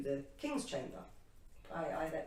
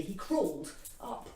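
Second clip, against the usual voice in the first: ordinary delivery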